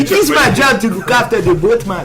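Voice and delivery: put-on overdone French accent, breaking into laughs; mocking French accent. Showing a friend how they sound, in mocking French accent. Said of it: "That's how you sound".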